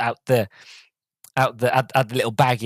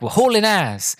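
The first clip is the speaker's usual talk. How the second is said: lightly voiced driver, higher pitched; a higher, lightly acted exclamation; driver's boast; 8 semitones higher than usual. Driver telling his passenger they're speeding, lightly acted boast. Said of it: "he's like, um".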